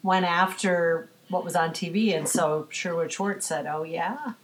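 ordinary talk

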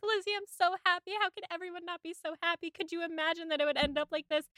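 high, fast, giddy rush; giddy joy. Retold or imitated. Jane telling Lizzie of her engagement, high and giddy. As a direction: gush it out near tears of joy. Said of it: "and she's like".

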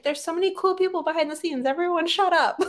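high, quick mock outburst; exasperated. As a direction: tell the fans off, fast. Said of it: "being like".

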